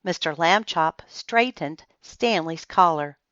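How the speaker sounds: ordinary delivery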